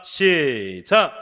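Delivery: loud, drawn-out cue to start; calling out. Real, not acted. Pastor cueing the congregation, loud drawn-out call.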